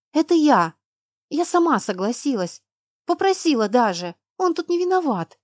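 high, insistent confession; pleading defence. A narrator's reading. Girl defends the father of her child, pleading.

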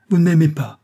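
loud, high-pitched outcry; impassioned cry. A narrator's reading. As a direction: cry out in feverish reproach. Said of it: "s'écria-t-il".

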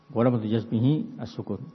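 usual speaking voice

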